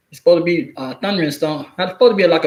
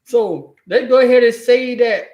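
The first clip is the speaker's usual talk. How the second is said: ordinary talk, nothing marked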